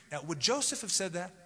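usual speaking voice

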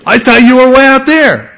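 high, loud startled protest; hammed-up shriek of surprise; startled outcry. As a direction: cry it out as if just badly startled. Acting out a startled spouse.

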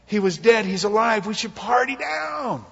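father celebrating the returned son, rising to a joyful shout. Acted. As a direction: throw the party open with delight. Father rejoices, shouts party.

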